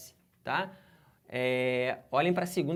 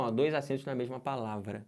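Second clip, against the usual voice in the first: ordinary delivery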